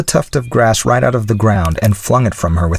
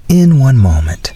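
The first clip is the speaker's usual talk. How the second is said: slow, mildly apologetic; a touch of apology, higher and louder; 7 semitones higher, 5 dB louder than usual. A narrator's reading. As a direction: stretch it out in polite apology. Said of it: "said the philosopher apologetically".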